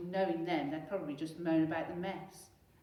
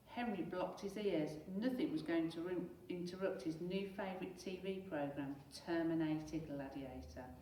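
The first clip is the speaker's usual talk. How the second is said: plain reading voice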